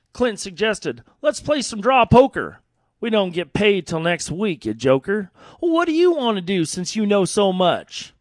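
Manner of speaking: lively storytelling cowboy poem; cowboy poem recitation. Acted. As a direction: recite it like a campfire yarn.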